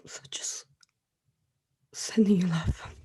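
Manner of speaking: soft, choked whisper of love; barely voiced through tears; tearful whisper. Real, not acted. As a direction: whisper while still crying. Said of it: "I'm trying to stop crying".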